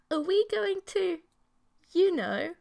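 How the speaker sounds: high, coy and teasing; hint at it playfully, voice lifted high; a shy monster girlfriend leaning in; coy creature girl. Acted.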